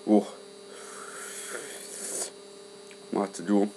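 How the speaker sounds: talking with a burning, numb mouth; mouth burning from spicy food. Real, not acted. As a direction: speak through the chili burn. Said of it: "roof of my mouth is kinda numb".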